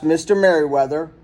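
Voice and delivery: ordinary delivery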